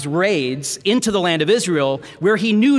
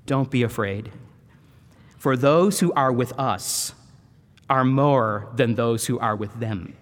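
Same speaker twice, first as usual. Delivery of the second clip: calm reassurance; 7 semitones lower than usual. Retold or imitated. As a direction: soothe a frightened servant, unhurried. Prophet calming his frightened servant.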